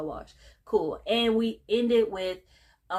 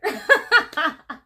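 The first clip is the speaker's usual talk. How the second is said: loud high laugh; 9 semitones higher, 9 dB louder than usual. Real, not acted. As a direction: burst out laughing loudly. Laughs after acting the line.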